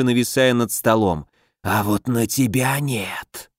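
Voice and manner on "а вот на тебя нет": rustle it like a dead thing speaking; inhuman rustling voice. Acted. The inhuman old man speaks.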